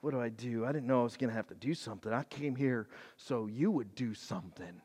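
fast, soft befuddled rambling; confused king. Retold or imitated. Befuddled king asking Elisha what to do, quick and soft. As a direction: stumble like you're lost and clueless. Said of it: "this confused, befuddled look".

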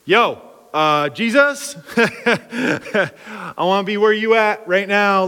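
excited, eager call to Jesus, stretched slow; Peter calling out. Retold or imitated. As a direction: hype voice of an eager disciple.

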